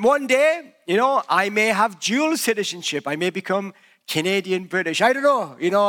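ordinary talk, nothing marked

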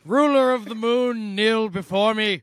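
loud, booming villain decree; booming demon lord. Acted. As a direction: proclaim it like a demon overlord. Mock villain proclamation as Buzz.

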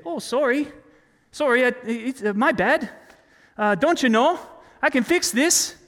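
high-pitched mock-apologetic Canadian; polite Canadian. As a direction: play an over-polite Canadian, halting and apologizing. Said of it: "like a polite Canadian and say".

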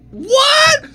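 high shocked yelp of disbelief; shocked shout. Real, not acted. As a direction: blurt it out, stunned. Shocked at the video.

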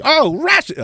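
high rising "oh, Rush"; mock dawning of understanding; mock realization. Listener finally catching the word.

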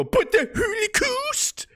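very high, slow, mocking cry; mock outrage. Mocking the reply given to young voters, high and drawn out. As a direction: stretch it out in an exaggerated plea. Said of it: "And they're gonna go".

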